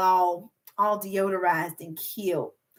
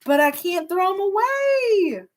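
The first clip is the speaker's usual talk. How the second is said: higher, slow, whiny reluctance; mock helpless whine; 9 semitones higher, 3 dB louder, slower than usual. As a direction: whine as if the perfumes can't go. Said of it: "I'm just like".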